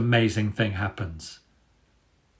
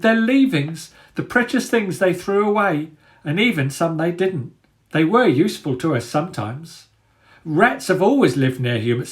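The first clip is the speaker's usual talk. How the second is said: put-on high, fussy old rat pleading; a timid old rat talking his way out of trouble; 8 semitones higher than usual. A narrator's reading.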